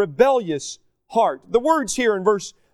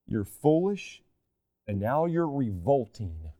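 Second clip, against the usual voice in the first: quiet, grave God voice; 7 semitones lower, 8 dB quieter than usual. Acted. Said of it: "And God says".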